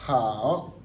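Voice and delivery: "hao" dips low and rises; "hao" drawn out on a falling-rising tone; full third tone. Shown as a demonstration. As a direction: let "hao" sink, then swing up. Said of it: "le troisième reste tel".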